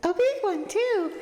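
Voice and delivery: very high-pitched silly voice; silly character voice. Acted. Voicing Tressa the game character in a silly voice, very high. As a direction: squeal it in a put-on, sky-high voice. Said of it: "No, don't give Tressa this voice".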